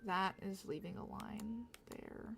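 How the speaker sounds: slow voiceless whisper to herself. Real, not acted. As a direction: murmur it under your breath, slowly. Whispered aside while drawing.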